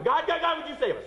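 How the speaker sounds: high, urgent mock plea; crying out for help. Preacher acting snakebitten people begging God, crying out. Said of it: "they cry out to him".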